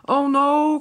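loud, high cry of alarm. A narrator's reading. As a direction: Diesel ten yelps in panic as the track rises. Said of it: "cried Diesel ten".

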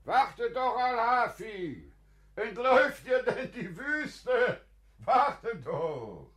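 calling after. Acted. Ruft dem Enteilenden nach.

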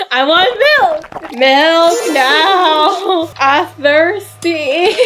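high, slow tantrum whine; wailing for milk, hammed up; mock toddler tantrum. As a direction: stretch each demand out like a toddler throwing a fit.

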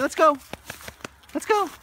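high, loud encouraging call; upbeat urging. Real, not acted. Trainer urging Bowie along the trail, high and loud. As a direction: hype the dog into moving.

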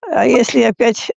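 ordinary talk, nothing marked

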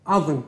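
the Arabic word עזם with a guttural Arabic ayin; עזם said the Arabic way, ayin from the throat and a Z. Shown as a demonstration. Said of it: "המקבילה לה בערבית". (הגייה ערבית של עזם, עם עי"ן גרונית)

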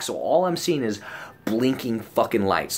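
normal talking voice

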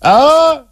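sudden high loud yelp of shock; startled shout. Acted. Scott yanked upside down into the air, high loud yelp. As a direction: cry out as the snare yanks him up. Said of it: "he shouted".

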